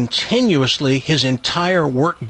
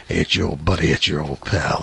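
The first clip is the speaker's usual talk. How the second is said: much lower, crooning late-night newsman; deep newsman voice; 10 semitones lower than usual. Retold or imitated. Newsman on the phone sweet-talking women, very low croon. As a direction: purr it down low like a sleazy radio baritone. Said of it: "It was beyond deep".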